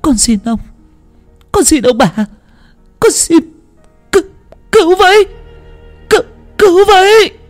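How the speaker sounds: high pleading rising to loud cries for help; plead, then scream for rescue; begging for help. A narrator's reading. Drowning servant girl begging, then screaming for help. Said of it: "có tiếng van xin của cô Bính".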